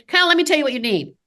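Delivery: fast, pushy "let me tell you" rattled off; rushed pushy pitch. Shown as a demonstration. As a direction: rush it like a salesman cutting the patient off. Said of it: "I'm going to slow down".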